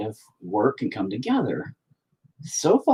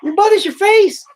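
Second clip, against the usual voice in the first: very high, loud retort; exasperated shouted banter; loud comeback; 8 dB louder than usual. Real, not acted. Parent throwing child's insult back.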